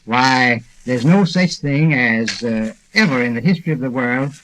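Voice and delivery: normal talking voice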